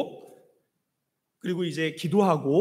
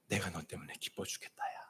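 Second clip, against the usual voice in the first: much softer, lower and intimate; hushed voice of God; 13 semitones lower, 19 dB quieter than usual. Acted. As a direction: murmur it as a loving secret. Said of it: "하나님이 이 예배하는 이 자리에서".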